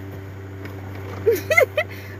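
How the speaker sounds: airy, squeaky unvoiced laugh; breathless high laugh. Real, not acted. Owner cracking up as her poodle tries to talk, breathless laugh. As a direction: laugh helplessly at the dog.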